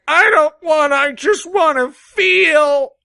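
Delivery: hammed-up whining, stretching the words out; mock upset dad.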